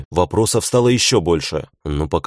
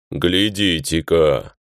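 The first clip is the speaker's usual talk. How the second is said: bass orc voice; much slower than usual. A narrator's reading. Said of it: "басом проговорил тот".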